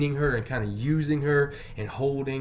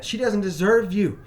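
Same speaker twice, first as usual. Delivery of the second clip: forceful retelling; 8 semitones higher, 6 dB louder than usual. Retold or imitated. First telling of his reply.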